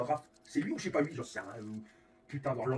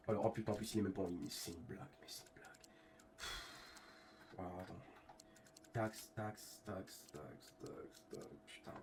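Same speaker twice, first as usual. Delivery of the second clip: annoyed muttering; 8 semitones lower, 15 dB quieter than usual. Real, not acted.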